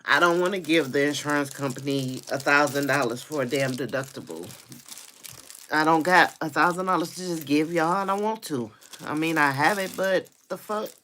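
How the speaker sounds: loud, heated rant; mad. Real, not acted. Refusing to pay the insurance deductible, loudly angry. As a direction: protest forcefully, voice climbing as it goes. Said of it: "I'm mad".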